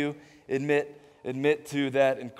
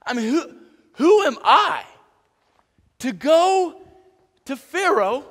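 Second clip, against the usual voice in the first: high, louder whiny self-doubt; Moses doubting; 8 semitones higher, 5 dB louder than usual. Retold or imitated. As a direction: plead your unworthiness. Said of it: "what Moses is saying is".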